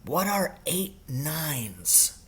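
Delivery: a soft, slow, secret question; murmured slowly behind a hand; hushed whisper. Acted. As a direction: sneak the question under the teacher's nose. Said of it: "whispered very softly to me".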